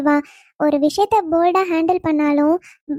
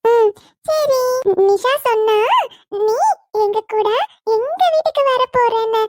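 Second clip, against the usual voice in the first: put-on little child voice; 6 semitones higher than usual. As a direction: play a small child coaxing her aunt. Child asks Meera about moving. (உயர்ந்த குழந்தைக் குரல்)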